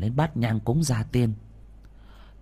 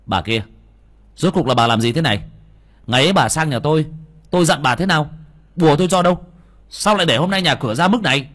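louder and higher, fast furious reproach; angry scolding; 5 semitones higher, 6 dB louder, faster than usual. A narrator's reading. Young master berates the woman who ignored his warning, furious. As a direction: tear into her, rising in anger.

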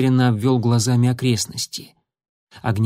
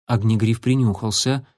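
usual speaking voice

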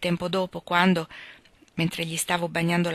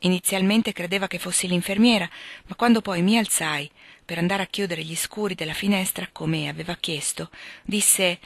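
plain reading voice

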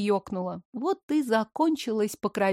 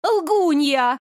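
loud, higher cry of liar; shouted accusation; 5 semitones higher, 5 dB louder than usual. A narrator's reading. Blonde shouts at the heroine, loud and high. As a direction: hurl the word at her. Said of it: "выкрикнула блондинка".

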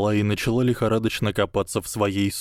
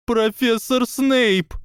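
slow, very high, louder tearful howl; tearful wail; 5 dB louder, slower than usual. Acted. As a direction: wail through tears. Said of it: "завыла Панси Паркинсон, заливаясь слезами". (завывая сквозь слёзы)

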